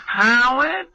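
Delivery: high, stretched-out calls into the phone; mother yelling hello. Retold or imitated. Mother calling into the answering machine, high and drawn out. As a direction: call out as if someone must answer. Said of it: "she's yelling into the answering machine".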